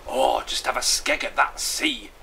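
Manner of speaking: excited character exclamation. Acted. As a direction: marvel at the view excitedly. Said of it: "said Bottle excitedly".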